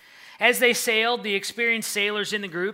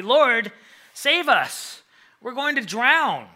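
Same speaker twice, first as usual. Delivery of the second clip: higher, louder desperate cry for rescue; frantic plea; 5 semitones higher, 6 dB louder, slower than usual. A narrator's reading. Disciples waking Jesus in the storm, frantic and loud. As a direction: plead in panic as if drowning. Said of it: "said frantically".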